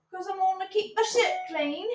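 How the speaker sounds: lightly voiced prim lady; prissy voice. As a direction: say it like a fussy lady. Prissy put-on voice.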